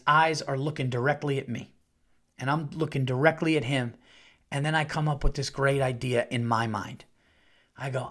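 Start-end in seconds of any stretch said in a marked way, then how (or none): none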